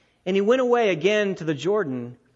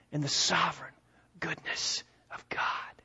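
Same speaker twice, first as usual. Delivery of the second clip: whispered assurance; 4 semitones lower, 8 dB quieter than usual. Real, not acted. Preacher whispering comfort to his congregation, hushed. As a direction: breathe the last words in a whisper.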